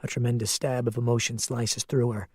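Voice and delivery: plain reading voice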